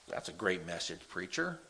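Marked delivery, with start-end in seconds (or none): none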